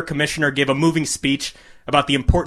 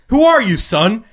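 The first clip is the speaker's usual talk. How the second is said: much higher, louder hyped announcer; announcer into the mic; 8 semitones higher, 3 dB louder than usual. A narrator's reading. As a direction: boom it out to the crowd. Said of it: "he asked".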